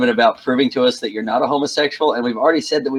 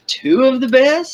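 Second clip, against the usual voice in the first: surprised; 13 semitones higher, 4 dB louder, slower than usual. Real, not acted. Surprised echo of an offer.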